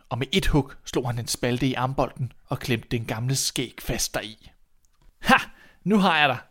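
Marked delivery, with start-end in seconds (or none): 5.2-6.4 loud, high-pitched gotcha; triumphant. Acted. Boy trapping the old man's beard, triumphant. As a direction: crow it in triumph.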